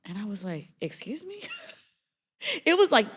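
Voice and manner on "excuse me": high, soft startled disbelief; shocked excuse me. Retold or imitated. Client stunned by her therapist's question, high disbelief. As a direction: caught completely off guard.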